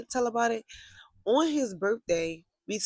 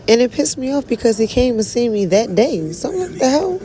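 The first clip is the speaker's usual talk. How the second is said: much louder, high angry outburst; loud heated rant; getting mad; 5 semitones higher, 13 dB louder than usual. Real, not acted. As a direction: boil over with anger. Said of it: "I'm getting so mad".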